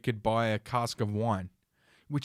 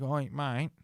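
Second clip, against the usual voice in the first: polite beggar; 2 semitones higher, faster than usual. Retold or imitated. Beggar approaching a stranger at the bench, imitated politely. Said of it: "he was very polite about it".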